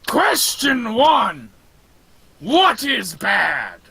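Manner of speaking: slow monster quizmaster; ponderous corpse-man questioning; Frankenstein's monster. Acted. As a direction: drag each word out like a lumbering giant. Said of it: "giant behemoth of a corpse man".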